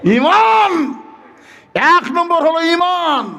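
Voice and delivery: very high, ringing preacher's call; drawn-out, high sermon headline; proclaiming. Real, not acted. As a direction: announce it to the whole crowd. Preacher proclaims his heading.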